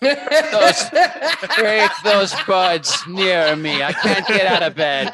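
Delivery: high, loud, laughing granny voice; old lady voice. Acted. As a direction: croon it slowly like an old grandmother. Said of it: "doing just an old lady voice".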